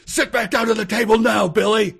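high, mock-angry snapping, laughing through it; a prince playing furious but cracking up; hammed-up angry prince. Prince scolds Billy at the table, high hammed-up anger. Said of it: "Prince Rayshawn said angrily".

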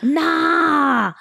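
loud, high, mock Scottish protest; bad Scottish accent. Imitating a girl in a video, bad Scottish accent. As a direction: cry out in a shaky attempt at Scottish. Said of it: "That's a shitty accent".

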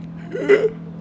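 sharp, high gasp of fright; startled gasp. Retold or imitated. Storyteller re-enacting hearing the first meow, frightened gasp. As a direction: suck in air as if spooked.

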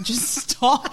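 a playful take on a fed-up Joe; mock exasperated Joe. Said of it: "He's like".

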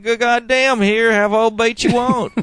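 put-on high, drawn-out North Carolina country accent; hammed-up Southern bait seller, slow and high; Bubba from North Carolina. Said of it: "Bubba from North Carolina".

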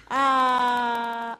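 high, loud, long "uh"; loud drawn-out wail. Pretend. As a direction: let out a long showy cry. Playfully cries out before answering.

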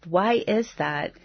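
normal talking voice

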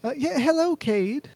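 high, exaggerated lady voice greeting Cade; falsetto Everly. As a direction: squeak out a hammed-up hello. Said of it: "I wanted to do the accent".

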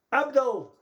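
high, loud call of a name; imitated shout. Relative calling out to a patient on the ward, shouted.

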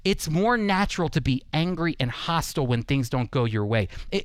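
ordinary delivery

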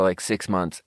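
ordinary talk

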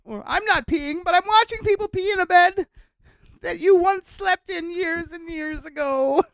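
squeaky, high, giddy Trump voice; gleeful high mock voice.

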